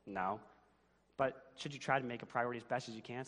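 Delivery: ordinary delivery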